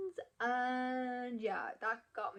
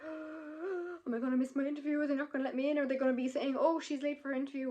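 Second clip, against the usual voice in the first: higher, louder flustered questions; retold panic; 5 semitones higher, 4 dB louder than usual. Applicant fearing she will miss her interview, flustered. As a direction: pile up anxious questions, pitched up. Retells fear of missing interview.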